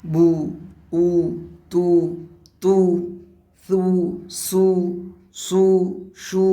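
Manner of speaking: each syllable ends on a short u, like বু, তু; pesh syllable drill. Shown as a demonstration. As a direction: recite the u syllables one at a time. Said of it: "পেশটাতেও আমরা এইভাবে উচ্চারণ করব".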